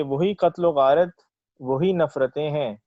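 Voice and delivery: verse lifted above everyday talk; ghazal recitation. Acted. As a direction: present the couplet to a listening mushaira. Recites own ghazal at mushaira.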